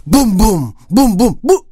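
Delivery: thud out the giant's footsteps; booming steps. A narrator's reading. Narrator booms the giant's approaching steps.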